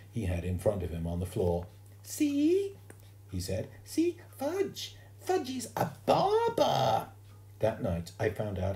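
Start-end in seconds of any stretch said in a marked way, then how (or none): 2.0-2.8 put-on very high toddler squeak; proud little boy. A narrator's reading. Toddler Fudge shows off. 3.8-7.1 put-on very high, slow toddler voice; proud little boy. A narrator's reading. Toddler Fudge announces himself.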